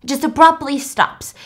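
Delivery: normal talking voice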